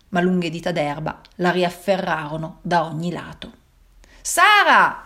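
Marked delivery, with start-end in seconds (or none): 4.2-5.0 high loud call of her name; father shouting. A narrator's reading. Father forcing the door calling his daughter, loud shout. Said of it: "gridò di nuovo il papà".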